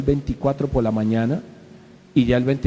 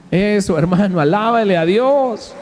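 raised, high, drawn-out shout of praise; exhorting call; 7 semitones higher, 4 dB louder than usual. Real, not acted. Pastor cheering the church into praise, exhorting call. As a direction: urge the crowd on, voice lifted high.

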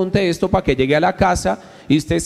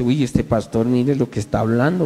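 grumbling churchgoer; 5 semitones lower than usual. Retold or imitated. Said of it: "Y ya dice".

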